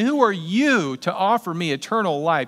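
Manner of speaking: loud, high mocking scorn at a madman; scornful accusation. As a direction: sneer it like a leader calling him crazy. Religious leaders scorn Jesus.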